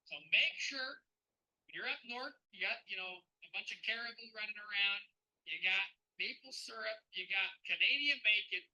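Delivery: hammed-up Canadian accent with punched openings; put-on Canadian accent, driving the point home. Shown as a demonstration. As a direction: lay on a folksy Canadian accent, emphatically. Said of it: "I'm gonna put my Canadian back hat back on".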